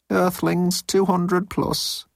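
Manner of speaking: high, drawn-out report; timid underling. A narrator's reading. Moan gives Jeltz the readings, high and timid. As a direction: report reluctantly, high and slow.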